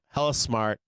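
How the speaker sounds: ordinary delivery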